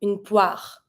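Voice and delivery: poire said pwar, ending on the R; French une poire. Shown as a demonstration. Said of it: "O I makes the sound wa".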